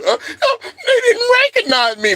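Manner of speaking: high-pitched whiny imitation; exaggerated high mocking voice; mock complaint. Mimicking Serena complaining about recognition.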